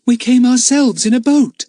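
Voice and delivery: put-on high, loud indignant girl's voice; girl bristling with indignation. A narrator's reading. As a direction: protest like an offended girl. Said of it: "said Lucy Ann indignantly".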